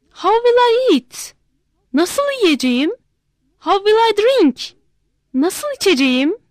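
high, pleading, panicked; a king realizing he may starve; worried cry. Acted. King despairs over gold.

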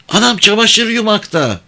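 put-on high-pitched girl's voice; high little reply; village girl. A narrator's reading. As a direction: answer him as a young village girl, pitched well up. Girl questioned by the man.

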